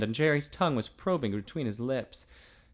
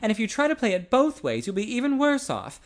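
urgent, louder and higher warning; an older friend warning the boy, pressing and intense; 9 semitones higher, 5 dB louder than usual. Acted. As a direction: press the point hard on a friend. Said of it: "his voice intense".

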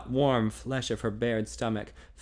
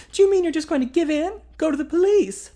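higher, louder defiant woman; defiant young woman; 8 dB louder than usual. A narrator's reading. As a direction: challenge him defiantly. Female character voiced.

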